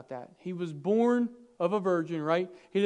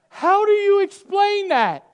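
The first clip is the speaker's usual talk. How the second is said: high, loud, slow cry of alarm; panicked shout; 14 semitones higher, 12 dB louder, slower than usual. Acted. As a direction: yell it like a frantic parent. Panicked parent's outcry.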